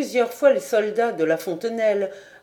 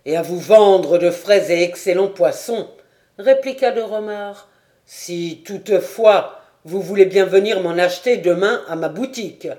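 louder, dry sarcastic reply; ironic retort; 6 dB louder than usual. A narrator's reading. Disguised lieutenant retorts to the sergeant, dry and ironic. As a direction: needle him with irony.

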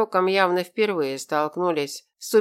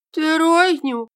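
shocked; 11 semitones higher, 6 dB louder than usual. Acted. Drunk god shocked by news.

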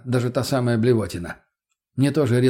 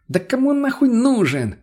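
high, raised exasperated outburst; irritated shout; 12 semitones higher, 4 dB louder than usual. A narrator's reading. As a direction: shout it, fed up with a dim listener. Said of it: "перешёл на крик Пётр". (раздражённый крик высоким голосом)

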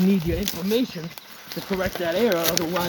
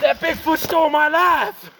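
angry; 9 semitones higher than usual. Acted. Gage's rant about Sasquatch.

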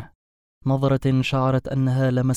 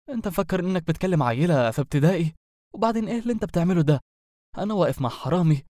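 much higher, fast outraged tirade; exasperated rant; 6 semitones higher, faster than usual. A narrator's reading. Werewolf ranting at the wizard picking the lock. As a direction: rant faster and faster in disbelief. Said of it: "قبل أن يصرخ".

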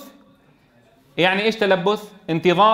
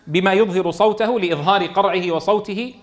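ordinary delivery

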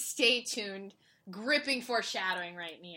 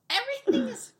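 high, louder forced reassurance; mock fine; 11 semitones higher, 6 dB louder than usual. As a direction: insist it's fine while clearly not. Insists all is fine, jokingly.

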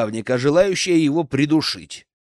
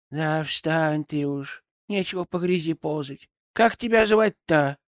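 a grumbling forest granny; old crone voice; 4 semitones higher than usual. Acted. Yaga voiced in story.

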